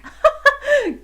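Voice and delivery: high loud giggle; queen's giggle. Acted. Queen giggling at the shy fairy. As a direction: burst into a girlish giggle. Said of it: "giggled the queen".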